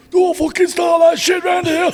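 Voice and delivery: high, loud, slow screech of a scolding mum; Sue's distinctive shout. Retold or imitated. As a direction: shout it as an exaggerated impression of a rival's mum, far above your own pitch. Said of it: "the most distinctive voice ever".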